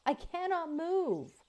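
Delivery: high, pitiful calf voice; wounded calf cry. Acted. Robot mimics a wounded calf to lure wolves, pitiful. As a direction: plead like a helpless calf. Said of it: "The calf's voice continued crying out".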